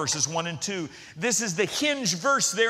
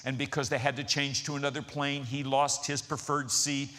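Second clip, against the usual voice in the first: normal talking voice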